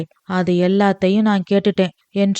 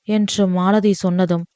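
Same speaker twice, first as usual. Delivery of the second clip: ordinary delivery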